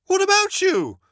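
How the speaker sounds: high, loud question; Motty bats Bertie's worry back, high and loud; indignant echo. Acted. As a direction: fire it back high and sharp.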